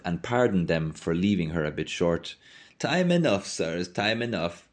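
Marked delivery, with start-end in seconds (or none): 2.8-3.5 high lilting voice. Acted. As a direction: lift "enough" up high and let it fall. Milkwoman repeats phrase.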